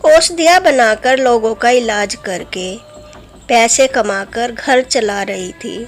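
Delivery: plain reading voice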